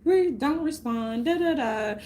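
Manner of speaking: high, silly singsong mockery of Marlon; mocking Marlon. Mocking Marlon Wayans on stage, in a silly singsong. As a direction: ham it up like a goofy rival comic. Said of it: "Marlon gonna sit up there and be like".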